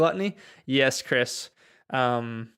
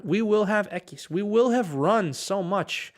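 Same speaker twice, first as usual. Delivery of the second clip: "will" stressed, pitched higher and slow; slow, high teacher's stress on "will"; 6 semitones higher, slower than usual. Shown as a demonstration.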